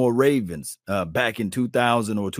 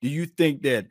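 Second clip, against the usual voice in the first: usual speaking voice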